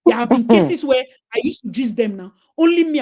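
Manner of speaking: ordinary talk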